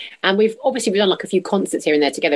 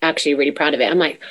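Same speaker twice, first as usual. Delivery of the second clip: ordinary delivery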